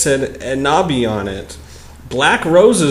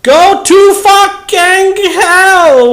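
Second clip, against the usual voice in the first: slow, high screamed curse; mock furious yell; 7 dB louder, much slower than usual. Reading a fanfic insult aloud, hammed-up slow high yell.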